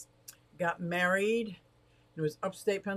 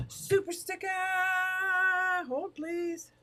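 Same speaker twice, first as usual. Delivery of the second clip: much higher, gleeful cries; excited squeal; 13 semitones higher than usual. Real, not acted. Streamer squeals with delight at a viewer's super sticker.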